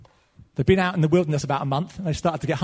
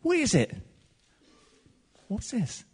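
puzzled asking; 5 semitones higher, 4 dB quieter than usual. Retold or imitated. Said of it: "they're all going around saying".

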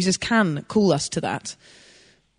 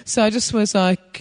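normal talking voice

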